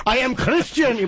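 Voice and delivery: put-on slow, heavy German accent; German villain accent. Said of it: "Hans Gruber".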